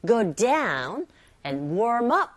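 DOWN and UP weighted over "go" and "warm"; stress on adverbs. Shown as a demonstration. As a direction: lean hard on down and up. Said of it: "부사 쪽에 힘을 실어서". (down과 up에 힘을 실어서)

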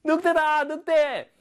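high-pitched acted cry of alarm; shouting. Shepherd boy running into town, shouting. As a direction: call out high to raise the alarm. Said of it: "Shouting, 소리 지르면서".

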